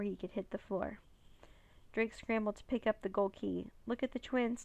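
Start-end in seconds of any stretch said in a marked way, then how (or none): none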